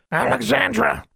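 high-pitched cawing bird voice; raven's call. A narrator's reading. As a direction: squawk the name like a raven. Raven calls back to its owner.

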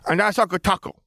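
put-on high toddler showing off toys; small child's voice. A narrator's reading. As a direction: announce it in a little boy's high voice. Toddler shows off toy starfighters.

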